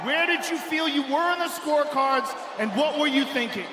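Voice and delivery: usual speaking voice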